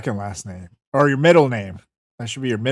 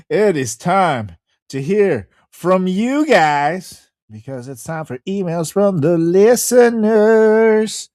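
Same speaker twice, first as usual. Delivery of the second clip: high, slow segment intro; announcer voice; 7 semitones higher, 4 dB louder, much slower than usual. Acted. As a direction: stretch it out like a show host.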